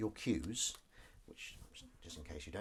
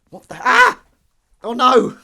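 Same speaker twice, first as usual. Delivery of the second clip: loud, high yelp of dismay; startled cry; 14 semitones higher, 23 dB louder, slower than usual. Real, not acted. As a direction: cry out as something drops. Drops microphone in washing up.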